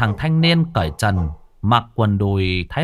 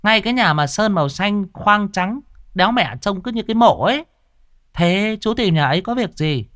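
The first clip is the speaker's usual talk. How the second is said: higher, chatty gossiping woman; old tea-stall woman; 9 semitones higher than usual. Acted. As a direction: gossip like a village tea seller. (giọng bà hàng nước cao, lanh lảnh)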